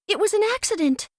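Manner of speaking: high and fast, a hint of fear; frightened. A narrator's reading. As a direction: blurt it out, scared little girl. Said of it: "Dorothy, trembling with fear".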